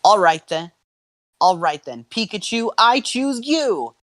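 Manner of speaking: high call building to a drawn-out finish; spirited trainer's call. Acted. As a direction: send your partner into battle. Ash sends Pikachu into battle.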